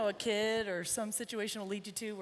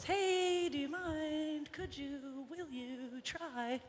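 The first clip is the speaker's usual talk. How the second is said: higher, slow, gentle request to a horse; polite asking; 7 semitones higher, much slower than usual. Shown as a demonstration. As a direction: ask the horse nicely and slowly, as a favour. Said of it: "You will always see me ask a horse".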